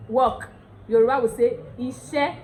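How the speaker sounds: ordinary delivery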